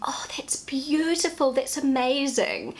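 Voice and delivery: high, airy admiring coo; gushing mum. Retold or imitated. Mum praising her child's picture, high gushing praise. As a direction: imitate a mum marvelling at a drawing. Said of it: "I was used to Mum saying".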